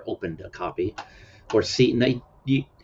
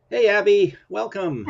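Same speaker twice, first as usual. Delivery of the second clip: cheerful greeting; 11 semitones higher, 5 dB louder, slower than usual. Real, not acted. Greeting a viewer.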